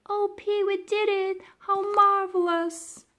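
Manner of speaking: very high, loud joyful cry; excited. A narrator's reading. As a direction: squeal with delight. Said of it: "clapped her hands together excitedly".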